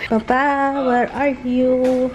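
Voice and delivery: drawn-out, sing-song call. Real, not acted. Vlogger looking for Papa, drawn-out calling. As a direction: stretch the words out to reach someone elsewhere.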